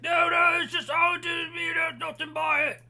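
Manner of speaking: very high, loud panicked plea; screaming. Acted. Guard dragged away by two others, screaming in panic. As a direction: scream protests while being hauled off. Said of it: "he's screaming".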